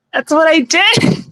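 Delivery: high, slow witch voice, rising end; witchy voice. Shown as a demonstration. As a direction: lilt it up like a witch. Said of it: "it sounds like a wicked witch laughing".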